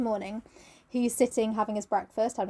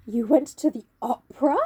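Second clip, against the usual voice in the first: slow question with a touch of pain; measured, hurt incredulity, lightly played; slow, pained disbelief; 2 semitones higher, 5 dB louder than usual. A narrator's reading. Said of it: "speaking very slowly and with a strange touch of pain".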